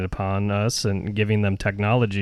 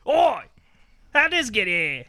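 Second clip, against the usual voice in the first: put-on British accent; 13 semitones higher, slower than usual. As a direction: shout it out as a Brit in a pub. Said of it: "are you doing a British guy discovering".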